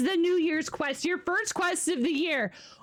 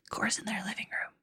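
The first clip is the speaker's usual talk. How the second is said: fast unvoiced whisper; 5 semitones lower, 6 dB quieter, faster than usual. Real, not acted. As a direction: whisper it quickly to yourself. Aside while lurking stream.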